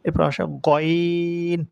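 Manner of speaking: গইন said slowly, long vowel; drawn-out ghain. Shown as a demonstration. Teacher introduces the letter গইন, slow and drawn out. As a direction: stretch out গইন. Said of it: "এভাবে বলতে হবে".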